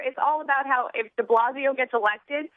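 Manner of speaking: usual speaking voice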